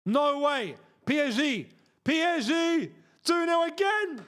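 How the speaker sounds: high, loud celebration shout, slowing at the end; excited yell. Real, not acted. Streamer cheering his team's second goal, excited yell. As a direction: erupt with joy at the goal.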